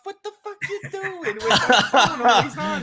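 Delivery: shrill-pitched mock scold about the phone; mocking mom scolding. Son imitates mom yelling about his phone, very high. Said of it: "she goes".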